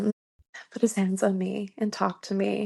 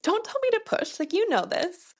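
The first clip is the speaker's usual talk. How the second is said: sharp, much higher snap; snapping; 10 semitones higher than usual. Retold or imitated. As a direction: bite back at him. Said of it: "snapped at him".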